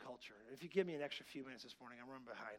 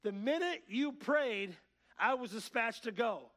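loud, slow, high-pitched heralding; drawn-out, forceful preacher voicing an angel; angel's proclamation; 7 semitones higher, 8 dB louder, slower than usual. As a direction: deliver it like an urgent messenger from heaven. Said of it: "the angel said".

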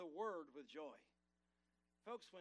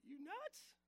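sharp, much higher squeal of disbelief; shocked disbelief; much slower than usual. Retold or imitated. Pastor recalling his shocked reply to God on the plane, high and startled. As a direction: retell the pastor's startled reaction, pitch jumping up. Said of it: "He said".

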